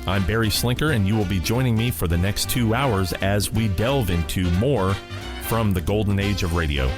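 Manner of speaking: ordinary delivery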